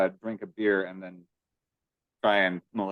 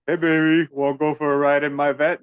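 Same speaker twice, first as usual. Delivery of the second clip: raised, loud mock pickup line; Bill Maher's come-on; 6 semitones higher, 5 dB louder than usual. Quoting him hitting on Bunny, loud mock come-on. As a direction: play a pushy come-on, pitched up. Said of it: "when they're quoting Bill Maher and saying".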